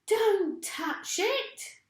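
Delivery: high, very slow warning cry; Missus Twit crying out. A narrator's reading. Missus Twit warns her husband off his head, high slow cry. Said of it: "cried Missus Twit".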